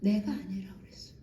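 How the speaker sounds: fast whisper; preacher whispering his admission to the congregation; whispered aside. Real, not acted. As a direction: drop to a quick hushed whisper. Confessing softly to congregation.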